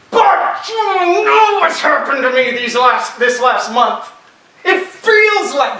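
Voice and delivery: high, loud, slow complaining doubter; voicing a doubter. Preacher voicing a struggling believer, high complaining. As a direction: whine like a believer who feels abandoned.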